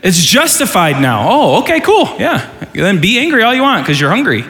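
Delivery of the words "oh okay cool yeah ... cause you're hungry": sarcastic parent. Retold or imitated. Sarcastic parent reply to kid.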